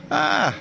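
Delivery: very high-pitched drawn "aah"; exclaimed "ah". Real, not acted. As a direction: let out a high, open "Ah!". Pausing during farm work.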